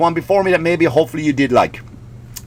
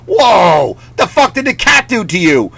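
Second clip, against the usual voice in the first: mock outrage; 4 semitones higher, 3 dB louder than usual. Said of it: "the animal rights people are like".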